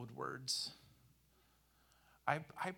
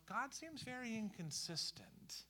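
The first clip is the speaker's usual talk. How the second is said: quiet inner thought; 9 semitones higher, 7 dB quieter than usual. Retold or imitated. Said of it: "you're thinking to yourself".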